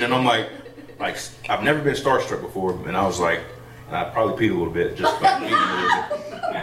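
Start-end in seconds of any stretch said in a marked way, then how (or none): 3.9-6.6 laughing. Real, not acted. Laughing at own starstruck story.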